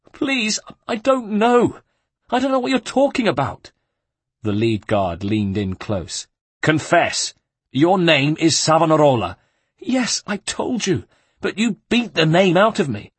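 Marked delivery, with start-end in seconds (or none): 0.1-3.7 very high, desperate plea; a terrified friar begging between blows; pleading monk. Acted. The tortured monk begs the guards, very high and desperate. 6.6-9.4 a louder, harsher interrogator; menacing guard. Acted. The lead guard interrogates the monk, louder and menacing. 9.8-13.1 a hurt friar protesting weakly; pained monk. Acted. The beaten monk answers the guard, high and anguished.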